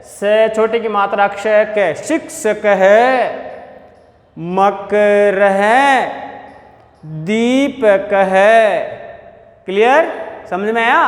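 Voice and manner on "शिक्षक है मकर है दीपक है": छात्र है drawn out, visarga breathed as है; slow visarga reading. Shown as a demonstration. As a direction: model it slowly. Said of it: "फिर दूसरी line में आ जाओ".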